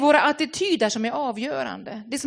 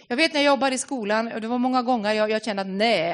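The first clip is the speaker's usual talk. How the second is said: ordinary talk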